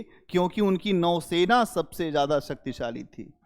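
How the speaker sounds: ordinary talk, nothing marked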